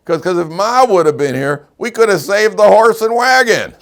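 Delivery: high, loud comic punchline; punchline shout. Acted. Father tells daughter why, loud and high. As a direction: sell the punchline big.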